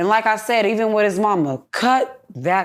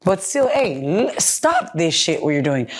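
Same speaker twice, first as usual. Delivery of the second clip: normal talking voice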